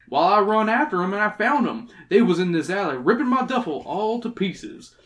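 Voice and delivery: put-on folksy old prospector telling his tale. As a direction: spin it out like a rustic old-timer. Reads old prospector's dialogue.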